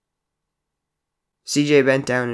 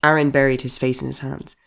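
ordinary delivery, nothing marked

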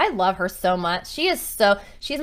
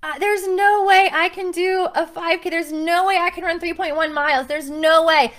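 higher, imitating a panicking beginner; mock panic, pitched up and pleading; panicky runner; 7 semitones higher, 4 dB louder than usual. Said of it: "and panicking like you wouldn't believe".

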